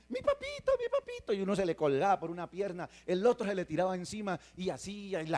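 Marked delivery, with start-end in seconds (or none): 0.1-1.3 put-on very high, delighted child squeal; excited children. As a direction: shriek like kids greeting their dad. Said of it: "sus hijos se tiraban y hacían una algarabía".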